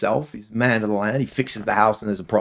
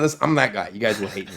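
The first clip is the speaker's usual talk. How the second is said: ordinary delivery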